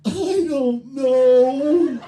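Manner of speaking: high, loud, very slow mock wail; mock crying boy. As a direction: blubber it like a crying child. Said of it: "the little boy goes".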